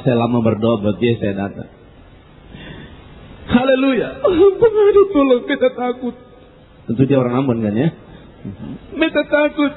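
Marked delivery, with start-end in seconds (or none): 3.5-6.2 high-pitched mock terrified begging; frightened wail. Dying man begs the visiting preacher, frightened wail. 8.9-9.7 high, whimpering mock fear; frightened. Dying man admits fear to the preacher, frightened.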